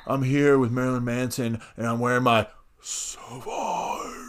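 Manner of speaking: slow, mock sultry cologne ad; Johnny Depp perfume ad. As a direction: ham up a slow cologne commercial. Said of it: "Johnny Depp, you don't talk like that".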